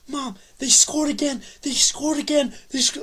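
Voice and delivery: high, loud, thrilled shout to mom; excited shout. Retold or imitated. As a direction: burst in yelling the good news. Running in to tell mom.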